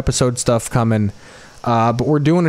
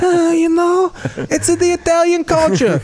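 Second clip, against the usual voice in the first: put-on Italian-American accent. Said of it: "I'm Jim Gandolfini".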